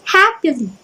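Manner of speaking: "happily" stressed on the first syllable; "happily" as one strong beat and two weak ones; dactylic stress. Shown as a demonstration. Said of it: "stress followed by two unstressed".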